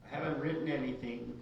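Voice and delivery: ordinary delivery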